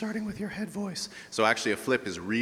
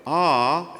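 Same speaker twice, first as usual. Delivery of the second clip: high note position; 6 semitones higher, 6 dB louder than usual. Shown as a demonstration. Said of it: "feel the position of the high note".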